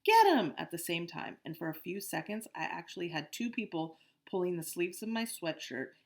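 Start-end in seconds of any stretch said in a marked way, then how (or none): 0.0-0.5 very high, loud scream; a bully screaming to catch the boy. A narrator's reading. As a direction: scream the order to chase. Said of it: "someone else screamed".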